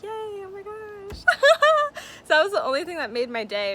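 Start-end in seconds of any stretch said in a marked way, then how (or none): 0.0-1.2 much higher and slower, a half-sobbing thrilled squeal; squealing yay. Retold or imitated. [name] recalling being chosen as model, thrilled squeal. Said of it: "And I was like". 1.3-2.2 a very high, loud, tearful laugh; shrieking laugh. Real, not acted. [name] laughing over being the model, high shriek.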